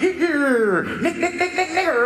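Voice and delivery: high whooping siren sound in words; siren imitation. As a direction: mimic a police siren glitching, pitched high. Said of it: "This is exactly how it sounded".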